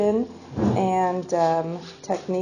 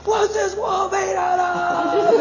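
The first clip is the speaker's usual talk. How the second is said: laughing mock shout, much higher; excited dream self; 13 semitones higher, 6 dB louder, slower than usual. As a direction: blurt it out in giddy wonder, slowly. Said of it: "I'm like".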